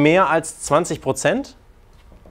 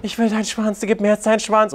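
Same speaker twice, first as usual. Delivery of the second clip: mock pleading woman; 6 semitones higher than usual. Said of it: "ist sie dann so".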